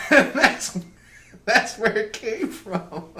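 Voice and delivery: high, loud words through real laughter; cracking up, slowing as the words come out; laughing while talking. Real, not acted. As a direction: barely get the punchline out for laughing. Cracking up at his punchline.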